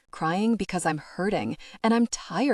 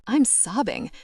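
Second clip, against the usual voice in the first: ordinary delivery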